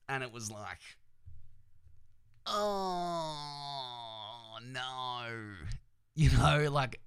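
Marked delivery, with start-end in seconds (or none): 2.4-5.5 long, high, drawn-out groan of dismay; retold wail of disappointment, held long; mock dismay. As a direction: draw each word out in pained disbelief. Retold reaction to fighter's knockout.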